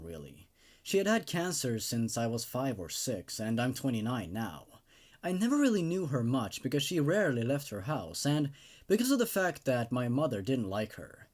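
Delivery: a touch hushed, even horror narration; creepypasta narration. A narrator's reading. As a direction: read it like a creepy tale told late at night. Reads the creepypasta story aloud.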